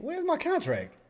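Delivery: high, soft plaintive question; baffled searching. Real, not acted. Trader hunting for a lost contract, baffled and high-pitched. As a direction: squeak it out to yourself, puzzled.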